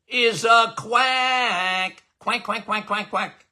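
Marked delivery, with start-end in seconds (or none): 2.2-3.5 soft, playful duck quacking; mock duck quacks. Mocking being called a quack.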